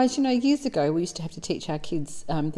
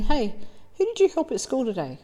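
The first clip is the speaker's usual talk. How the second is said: a hint of mum, pitched higher; acted mum; 6 semitones higher than usual. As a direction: lightly play a mother asking her child, higher voice. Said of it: "She just says to Johnny".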